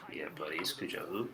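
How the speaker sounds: hushed, teasing whisper; whispered taunt. Real, not acted. Gamer quietly gloating at the beaten Cowboys. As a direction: whisper the gloat like a sly secret. Teasing rival after touchdown.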